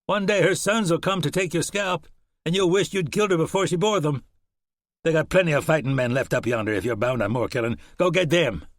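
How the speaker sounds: lightly voiced frontiersman scolding; hint of a stern Shannon. Shannon sending the horseman off, stern.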